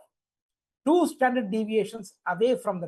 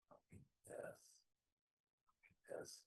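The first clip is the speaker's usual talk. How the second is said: whispering; 30 dB quieter, much slower than usual. Real, not acted. Muttering to himself while copying.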